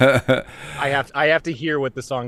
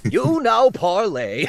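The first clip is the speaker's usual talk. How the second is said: put-on high old professor voice, laughing through it; lightly laughing old scientist voice; Professor Farnsworth; 8 semitones higher, 4 dB louder, slower than usual. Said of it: "sounds a little bit like Professor Farnsworth".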